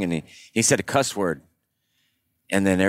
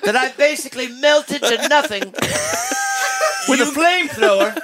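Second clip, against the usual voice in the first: mock preacher rant. Speaker who torched the front rows scolding the crowd, laughing mock sermon. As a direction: scold the crowd like a fiery evangelist, cracking up.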